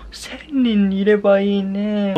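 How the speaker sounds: high, drawn-out, dreamy exaggeration; playful wishing. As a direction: stretch it out high, as a wishful joke.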